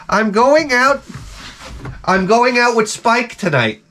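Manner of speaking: high, slow girly mock voice; breezy daughter announcing her date; mock teenage daughter.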